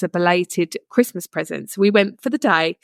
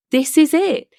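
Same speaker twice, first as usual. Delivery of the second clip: high, louder burst of excitement; excited realization; 8 semitones higher, 4 dB louder than usual. Retold or imitated. Sisters realising their energies rose, excited and louder. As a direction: exclaim it as a sudden discovery.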